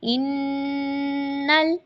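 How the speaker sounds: इन्नल drawn out, noon hummed, lam medium; full ghunna. Shown as a demonstration. Teacher repeating इन्नल very slowly. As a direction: stretch the nasal in इन्नल. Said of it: "लाम, हम्म, दरमियान में".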